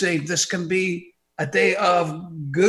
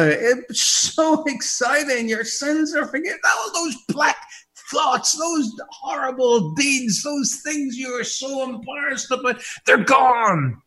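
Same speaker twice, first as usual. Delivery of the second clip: excited preaching; 9 semitones higher than usual. Real, not acted. Preacher shouting to listeners that sins are gone, joyful. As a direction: preach it like the best news ever.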